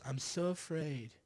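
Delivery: softly mocking a scared staffer with slow, drawn words; mock fearful whimper. Mocking fired NSC staff, slow scared whimper. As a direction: sell the fake fear, a little slow.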